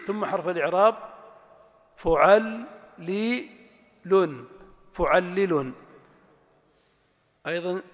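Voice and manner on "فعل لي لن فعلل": slow, split into syllables; syllable drill. Shown as a demonstration. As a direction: sound out each piece. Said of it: "syllable drill".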